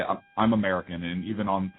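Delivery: ordinary talk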